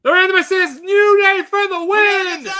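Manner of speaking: high, loud hyped-up announcer yell; screaming announcer climax; shouted announcement. Acted. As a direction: shout the big reveal at the top of your range.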